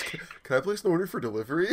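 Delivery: mock phone customer, giggling; laughing mock customer. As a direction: rattle off the order while cracking up. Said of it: "Or he goes".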